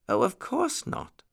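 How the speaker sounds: high-pitched muskrat lady voice; agreeable lady nurse. A narrator's reading. Muskrat nurse agreeing with the lion, high-voiced.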